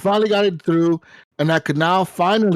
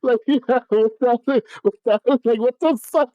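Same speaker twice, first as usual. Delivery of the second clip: laughing; 8 semitones higher than usual. Real, not acted. Laughing, high, at the absurdity.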